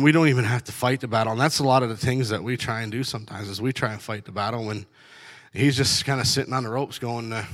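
usual speaking voice

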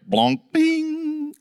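high, jokey clunk and ding noises; imitated car clunk noises. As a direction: make the car go blonk and bing.

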